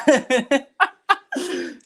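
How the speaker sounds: high-pitched helpless laughter; cracking up. Real, not acted. Storyteller breaking up at his own punchline, real laughter. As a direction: burst out laughing.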